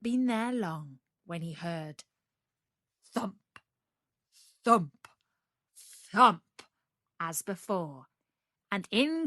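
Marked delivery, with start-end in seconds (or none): none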